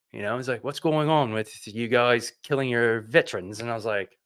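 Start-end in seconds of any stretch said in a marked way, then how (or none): 0.6-3.6 badly faked British accent; terrible British accent. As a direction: imitate a British friend in a clumsy accent. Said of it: "that's terrible British accent".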